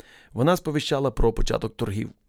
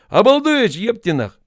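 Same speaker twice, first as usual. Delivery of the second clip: loud, much higher rude bark; angry yell; 10 semitones higher, 7 dB louder, slower than usual. A narrator's reading. Officer yelling at a slow recruit, loud high angry yell. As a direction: blast the insult at him.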